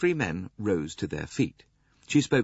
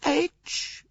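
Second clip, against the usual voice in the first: very high, slow, deliberate grande dame; imperious old princess, cold; slower than usual. A narrator's reading. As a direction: correct him coldly as an old princess.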